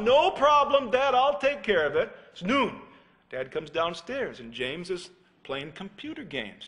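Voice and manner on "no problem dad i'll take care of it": breezy brush-off. Retold or imitated. Son brushes dad off.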